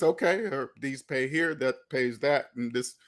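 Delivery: low, soft offhand muttering; indifferent. Acted. Speaker showing how little taxes bother them, indifferent. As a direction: act out unbothered bill-paying, voice dropped. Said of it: "don't make me feel like anything".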